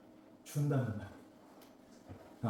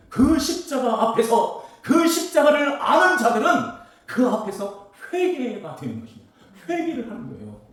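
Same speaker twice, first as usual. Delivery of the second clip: much higher, louder ringing sermon cry; preaching at full force; 8 semitones higher, 10 dB louder than usual. Acted. Preacher to congregation about storms of the world, fiery preaching. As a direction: lift the whole church with it.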